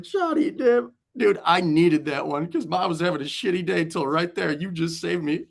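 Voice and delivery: high-pitched laughing teammate, cracking up; amused teammate. Retold or imitated. Teammate laughing at the thrown bat, high and amused.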